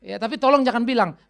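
ordinary delivery

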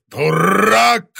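loud, high roar; growl. A narrator's reading. Monster raging at the boy, loud growl. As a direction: roar the insult out. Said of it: "прорычал монстр".

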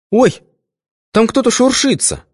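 very high startled squeal; frightened cry. A narrator's reading. Svetka running out of the bushes, frightened cry. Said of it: "выскочила оттуда с криком".

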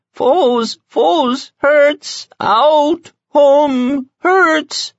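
very high, strange whimpering creature voice; a wounded sphinx moaning in hiding; tiny high creature whining single words; piteous mumble. A narrator's reading. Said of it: "crouched and mumbled piteously".

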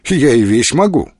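ordinary delivery

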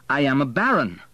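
touch of wounded grandeur, voice lifted; a little haughty. A narrator's reading. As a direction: pull rank with some pride. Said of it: "a little haughtily".

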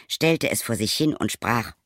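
plain reading voice

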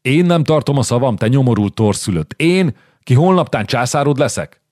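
angry shout. Acted. Zsigmond yells at the Milanese duke, furious and loud. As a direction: roar at him like an insulted king. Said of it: "Zsigmond rekedten üvöltött".

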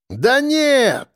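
high, drawn-up call from the bushes; calling back. A narrator's reading. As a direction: call back in dismissive protest, pitched high. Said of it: "откликнулся из зарослей Железнозубый".

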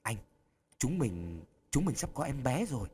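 thầm thì. A narrator's reading. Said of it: "Mỹ Lan thầm thì vào tai Tony Phương".

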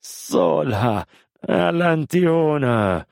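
high, drawn-out anxious call; calling out loudly. Acted. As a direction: call out for someone in the dark, stretching the words. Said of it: "صاح بصوت مرتفع".

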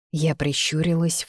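ordinary delivery

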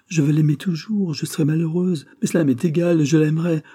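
high, fervent declaration; acted lovelorn defiance; impassioned vow. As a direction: declare it stubbornly, pitched well up. Heroine vows to love him regardless.

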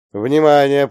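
urgent dispatcher announcement; loud alarm call. Acted.

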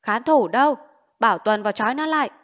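loud, quick barked command; shouting orders. Acted. Village official shouting for his guards to seize the man, loud fast command. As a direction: yell for the guards to tie him up, fast. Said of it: "Cụ Tránh thét".

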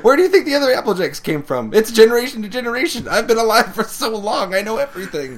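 loud, high, fast rant; hammed-up conspiracy outburst; mock frantic revelation. Co-host riffing as ageless Applejack, loud high mock rant.

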